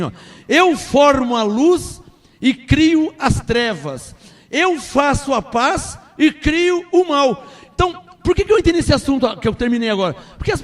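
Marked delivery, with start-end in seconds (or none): 0.5-7.5 slow, weighty reading of the verse; slow scripture reading. Shown as a demonstration. Preacher reading scripture aloud slowly. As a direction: read God's words slowly and solemnly. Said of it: "Deus diz assim".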